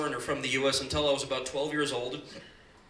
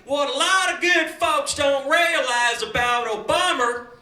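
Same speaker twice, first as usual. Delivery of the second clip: Republican candidate; 9 semitones higher, 5 dB louder than usual. Retold or imitated. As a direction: rant like a folksy fearmongering candidate. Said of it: "they're standing there like".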